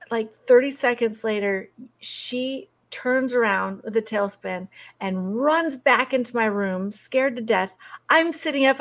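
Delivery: ordinary delivery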